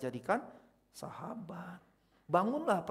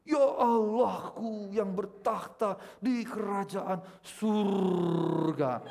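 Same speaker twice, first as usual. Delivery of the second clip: slow, drawn-out churchy intonation, pitched up; affected pastor prayer voice; 4 semitones higher, 4 dB louder, much slower than usual. Shown as a demonstration. Mocking showy pastors at prayer, overdone holy intonation. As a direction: ham up a showy pulpit prayer. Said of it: "Intonasi juga nggak perlu dibuat".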